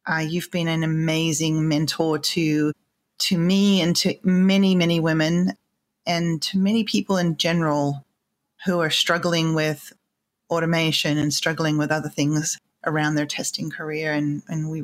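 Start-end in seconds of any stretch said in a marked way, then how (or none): none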